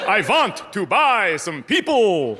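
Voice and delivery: put-on slow, hammy vampire accent. Old Confederate answering as a vampire, slow vampire voice. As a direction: draw out each word like a movie vampire. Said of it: "Are you a vampire?".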